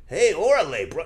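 hammed-up fake Mexican accent. Shown as a demonstration. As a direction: overdo a clichéd Mexican greeting. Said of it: "I don't go like".

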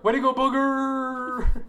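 high, slow cheer of Booger; cheering Raven. Retold or imitated. Raven blending in, cheering from the sidelines, high slow cheer. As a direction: cheer him on like a fake fan. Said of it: "she's like".

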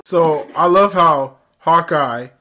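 ordinary talk, nothing marked

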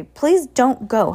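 begging. Acted. Heidi stopping Bruce from leaving, begging. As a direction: drag out the plea. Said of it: "Heidi begged".

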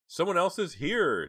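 high, loud, slow panicked cry; frightened cry. A narrator's reading. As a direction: cry out in alarm. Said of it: "Jade cried".

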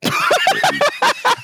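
squealing high laugh. Real, not acted. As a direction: burst into laughter. Laughing at the joke.

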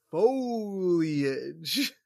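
"Foliage" stretched out as FOHHH-lee-ij; drawn-out correction. Retold or imitated. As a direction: linger on each syllable of "Foliage". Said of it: "It's not foliage, Mom".

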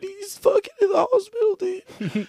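high, crying hammed-up grief; mock sobbing friend. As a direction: sob out the bad news. Acted news of friend in hospital.

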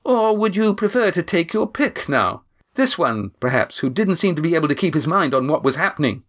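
mock her with cold sarcasm; sneering woman. Janice taunting.

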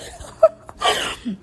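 breathy high giggle; high laugh. Real, not acted. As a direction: burst into a giggle. Laughs at her own stumble.

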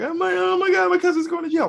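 high-pitched mock wail; imitating bawling cousin. Cousin panics over relative going to jail, mock bawling. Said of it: "my cousin is bawling".

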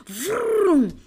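revving "vroom", pitched high; high rolled vroom of a speeding RV; imitated engine vroom. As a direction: make a revving engine noise.

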